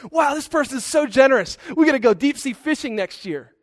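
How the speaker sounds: put-on high, bright wonder as children; excited kids. Pastor recalling how the kids gushed, excited. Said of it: "we were so excited".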